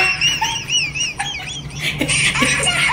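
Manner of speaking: high, long burst of laughter; long high cackle. Real, not acted. Vlogger doubled over at her own story, long high cackle.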